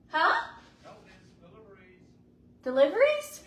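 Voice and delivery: high, loud call toward the door. Real, not acted. Answering the doorbell from inside, loud high call. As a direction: shout to the person outside.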